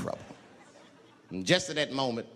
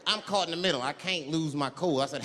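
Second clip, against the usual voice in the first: ordinary talk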